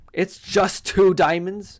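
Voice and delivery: indignant at a measly find; mock outrage, slow and loud; riled up. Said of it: "got me a little riled up".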